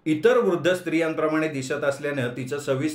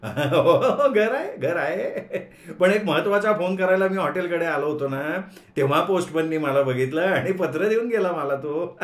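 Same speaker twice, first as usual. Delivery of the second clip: bluffing through nervous laughter; nervous laughing; 3 semitones higher than usual. Acted. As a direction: laugh your way through the lie.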